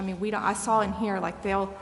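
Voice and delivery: ordinary talk, nothing marked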